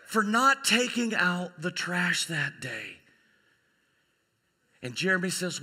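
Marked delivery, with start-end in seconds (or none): 0.1-3.0 high, slow, overdone daughter's plea; hammed-up pleading. Daughter begging dad over forgotten trash, hammed-up pleading. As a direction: play up the guilt, drawing it out. Daughter begs forgiveness, acted.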